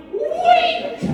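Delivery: comic high-pitched outburst; mock yell. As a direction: yelp it way up high, gleefully silly. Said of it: "stick our heads out the door and yell".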